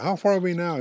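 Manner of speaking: high, loud storybook call. Acted. Swallowed porcupine asks buffalo how far, calling out. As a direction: call up a question from inside.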